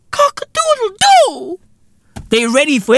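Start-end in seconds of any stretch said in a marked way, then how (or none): 0.1-1.6 cock-a-doodle-doo crowed high like a rooster. Retold or imitated. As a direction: throw your head back and crow. Rooster crow imitation.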